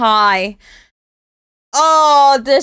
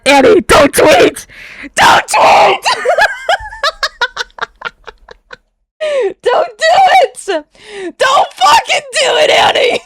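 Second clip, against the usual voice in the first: higher and louder, begging at the screen; pleading yell; 11 semitones higher, 3 dB louder than usual. Real, not acted. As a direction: yell a desperate warning, half laughing. Yelling warning at the screen.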